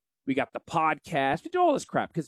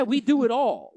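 higher, punchy TV-pitchman hype; Billy Mays pitch; 8 semitones higher than usual. Retold or imitated. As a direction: sell it like an infomercial host. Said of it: "a pretty good Billy Mays".

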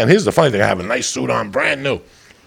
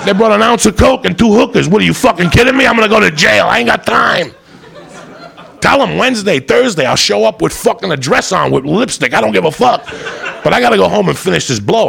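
frantic pleading; 7 semitones higher than usual. Retold or imitated.

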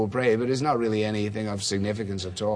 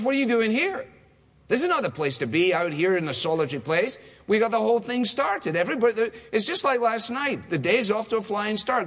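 much higher, pressing and incredulous; urgent, disbelieving disciples; 9 semitones higher than usual. Shown as a demonstration. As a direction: push him: what are you doing here. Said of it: "But I think it's kind of".

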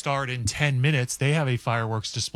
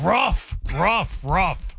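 higher, falling-off ruff ruff ruff; loud bark; 5 semitones higher, much slower than usual. Shown as a demonstration. Co-host shows a shut-down forearm as a loud bark. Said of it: "it's a loud bark".

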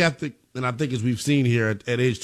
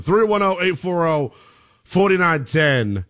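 normal talking voice

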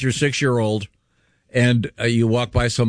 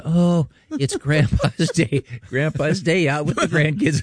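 slightly raised, drawn-out cooing; lightly voiced old folks; 4 semitones higher, slower than usual. Old folks spotting grandpa at the cafeteria, lightly voiced cooing. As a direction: coo it like doting strangers, lightly acted.

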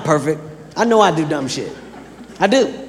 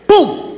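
high shouted door boom; door slam boom; 10 semitones higher than usual. Retold or imitated.

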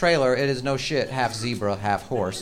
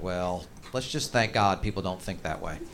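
low, slow and dry, a lightly voiced army colonel; deadpan colonel; 9 semitones lower, 8 dB quieter than usual. Colonel answers the comedian's question, low and slow. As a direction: drawl it like an unbothered officer. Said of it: "And he's like".

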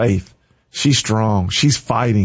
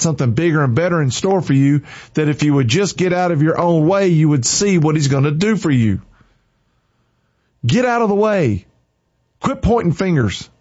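preaching; 4 semitones higher than usual. Acted. Preaching climax to viewers.

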